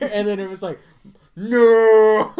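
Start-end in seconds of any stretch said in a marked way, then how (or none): 1.4-2.3 long, loud, high cry of defeat; drawn-out theatrical "no"; anguished mock wail. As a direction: howl it in hammed-up despair. Retold despair, picked at last.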